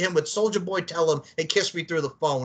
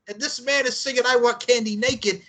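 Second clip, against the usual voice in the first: ordinary delivery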